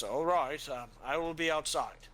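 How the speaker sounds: a hint of a blunt soldier; gruff guard. GM voices Ulfrig.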